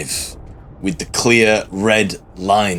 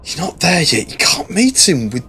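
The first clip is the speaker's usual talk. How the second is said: high, desperate plea to a dying comrade; pleading corporal; 7 semitones higher than usual. Acted. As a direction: beg him to hang on. Corporal begging Belloc to live.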